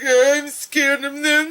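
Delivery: high, slow whimpering mock fear; hammed-up scared voice; mock scared wife. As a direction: whine it like a frightened wife. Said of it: "she's like".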